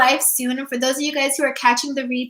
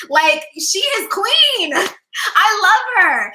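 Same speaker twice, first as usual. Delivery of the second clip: high, slow, delighted gush with a laugh; 6 semitones higher, 3 dB louder, slower than usual. Acted. Coach gushing to viewers about her dream self, delighted. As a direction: swoon over your dream self.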